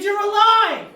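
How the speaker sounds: high, loud cry of amazement; astonished shout. Acted. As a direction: yell it in stunned relief, drawn high. Acted amazement that mom lives.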